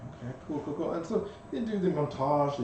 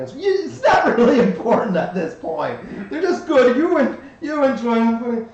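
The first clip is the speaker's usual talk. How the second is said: louder, higher through laughter; laughing; 8 semitones higher, 9 dB louder than usual. Real, not acted. GM laughing while narrating the dining cart, real laughter. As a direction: crack up mid-sentence.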